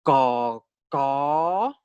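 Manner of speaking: slow teaching demo, vowel held out; very slow pronunciation. Shown as a demonstration. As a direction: stretch each "ko" for a new learner. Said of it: "you will pronounce very slowly".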